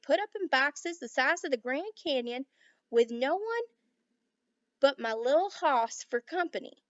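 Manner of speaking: put-on a drunk cowboy railing loudly in Texas twang; angry Texas cowboy. A narrator's reading. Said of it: "with his strong Texas accent".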